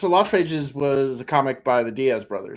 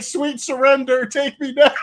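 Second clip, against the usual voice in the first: mock dramatic surrender; 11 semitones higher than usual. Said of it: "I'm like".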